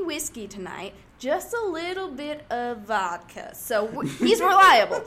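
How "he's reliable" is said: emphatic. Real, not acted. Vouching loudly for witness.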